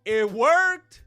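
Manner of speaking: loud, high triumphant verdict; emphatic exclamation. Real, not acted. Host declaring the fighter's angle worked, emphatic. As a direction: punch it out loudly.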